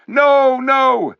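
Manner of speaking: high, loud cry of denial; crowd shouting. A narrator's reading. The crowd shouting back at the Tarkan, high and loud. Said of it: "shouted most of the crowd".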